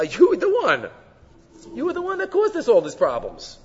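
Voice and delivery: blaming. Acted. Adam blaming God for his problems, high-pitched accusation. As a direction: pin all the trouble on the listener. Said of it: "As if he's blaming God".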